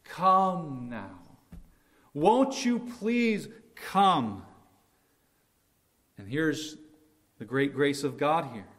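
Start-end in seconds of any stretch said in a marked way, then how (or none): 0.1-1.2 slow, gentle plea as God; pleading. Acted. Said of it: "Now he pleads". 2.1-4.4 slow, higher, earnest plea; God pleading with those who offend him. Acted. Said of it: "Now he pleads".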